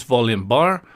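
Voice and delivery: ordinary talk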